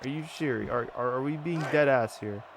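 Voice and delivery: ordinary delivery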